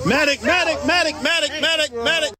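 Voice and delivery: urgent call for help; man down shout. Real, not acted. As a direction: call out for a medic. Calling for medic in crowd.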